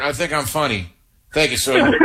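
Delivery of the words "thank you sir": high put-on voice; movie soldier impression. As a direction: play the eager soldier answering sir. Acting movie scene dialogue.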